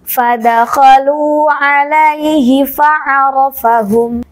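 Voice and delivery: slow chanted recitation. Shown as a demonstration. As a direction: recite slowly, drawing out vowels. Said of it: "Coba kita baca dengan nada tinggi".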